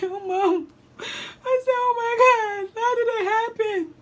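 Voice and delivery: very high tearful wail; mock crying. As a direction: act out a sobbing daughter lamenting to her mom. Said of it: "I'm crying too".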